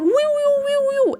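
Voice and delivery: high warbling woo sounds; imitated sci-fi warble. Describing the time stutter, high imitated warble. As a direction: mimic the time glitch noise.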